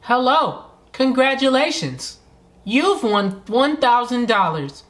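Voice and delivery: high, slow robotic announcer. Retold or imitated. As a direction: say it like a flat robot. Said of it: "She said that like a robot".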